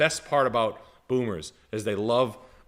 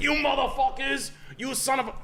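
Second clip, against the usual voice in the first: mock angry shout; 13 semitones higher than usual. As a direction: yell at the sons who pranked him. Dad yelling at his pranking sons.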